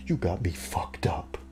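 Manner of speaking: soft mock threatening whisper; menacing whisper. Boyfriend threatening his buddy, mock menacing whisper. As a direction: hiss it like a quiet threat. Dude messes up the role.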